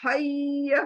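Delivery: हैय breathed out from the middle of the throat. Shown as a demonstration. Teacher showing the throat sound. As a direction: open the throat for the h. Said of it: "देखिए हलक के अंदर से".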